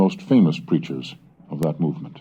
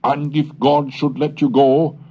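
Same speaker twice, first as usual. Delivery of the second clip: fiery sermon reading; 12 semitones higher, 4 dB louder than usual. Acted. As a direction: warn the congregation of hell like a revival preacher. Reads the sermon as preaching.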